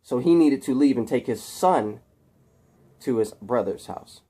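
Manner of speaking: plain reading voice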